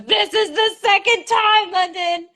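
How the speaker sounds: high, drawn-out imitation of an exasperated roommate; mock exasperation. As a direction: wail it slowly, high-pitched, as the fed-up roommate. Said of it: "because of her reaction. She goes".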